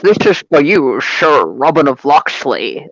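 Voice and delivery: slowed, mock Scottish Sean Connery accent; Sean Connery impression. Podcast host imitating Sean Connery's line in the film, mock Scottish accent. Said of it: "that's my awesome accent".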